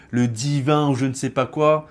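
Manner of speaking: normal talking voice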